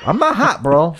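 squeaky high I'm not hot; high defensive protest. Real, not acted. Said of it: "don't get hot".

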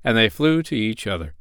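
usual speaking voice

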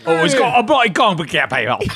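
high, exaggerated British outburst; shitty British accent. Host heckling the presenter's story, high mock British. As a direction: squawk it in bad British. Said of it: "activate shitty British accents right now".

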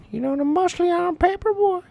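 very high cartoon old-man voice; creepy old man in a high falsetto; old man from Family Guy. Shown as a demonstration. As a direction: pipe it up high like a cartoon codger. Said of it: "old man from Family Guy".

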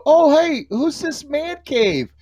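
put-on high, slow, bewildered old man; confused old man. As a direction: play a muddled old man greeting a stranger. Imitating confused future self.

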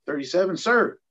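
high, loud recruit's answer, rising to "sir"; military reply. Retold or imitated. Recruit answers the captain's age question, sounding off loudly. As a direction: sound off to an officer.

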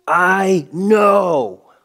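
high, loud exasperated reply; frustrated retort. Retold or imitated. Dad snapping back at his son, high loud exasperation.

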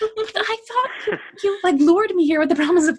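high, laughing protest; laughing mock complaint. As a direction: whine it through laughter. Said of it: "And I was like".

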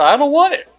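high-pitched mock refusal; exasperated retort. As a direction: refuse as if it's absurd, voice jumping up. Acted retort to customer's offer.

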